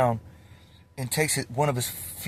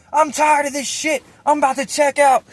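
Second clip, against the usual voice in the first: high, loud mock exasperation; imitated fed-up yelling; 13 semitones higher, 6 dB louder than usual. Prank victim fed up with cellmates, high angry yelling. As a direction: holler like a man who has had enough.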